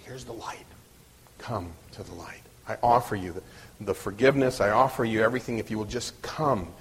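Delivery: soft, low and tender, as Jesus inviting; gentle invitation. Acted. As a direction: gently offer it, voice dropped low. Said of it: "very, very gently saying".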